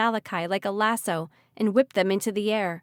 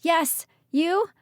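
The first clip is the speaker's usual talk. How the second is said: high, raised answer; called reply; 9 semitones higher, 4 dB louder than usual. Acted. Ridley calling back to Archer in the storm. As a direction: call back over the wind. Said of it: "she responded".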